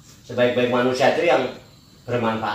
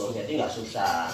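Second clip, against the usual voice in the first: normal talking voice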